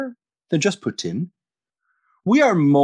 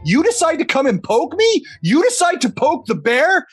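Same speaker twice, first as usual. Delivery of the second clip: mock outrage; 10 semitones higher than usual.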